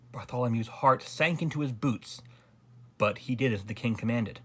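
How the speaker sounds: ordinary delivery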